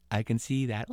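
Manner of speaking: unimpressed. Acted. Boy answers the ghost dryly.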